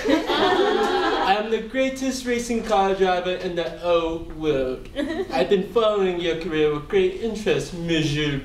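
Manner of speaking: a smug French racing driver with a hint of French accent; Jean Girard.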